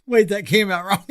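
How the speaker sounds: high-pitched, through giggles; laughing. Real, not acted. Host cracking up at an accidental innuendo, laughing. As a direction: squeeze the words out mid-laugh.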